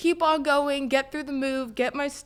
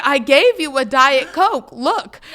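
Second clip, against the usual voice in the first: louder, slow, pointed; mock snippy worker; 10 dB louder, slower than usual. Drive-thru worker insisting to the customer, mock snippy and loud. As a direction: talk down to the customer, a bit loud. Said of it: "And she's like".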